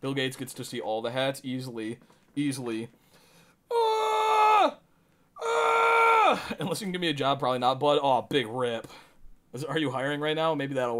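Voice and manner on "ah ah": high sudden scream; shrill shriek. Real, not acted. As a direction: let out a startled shriek.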